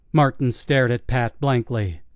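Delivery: plain reading voice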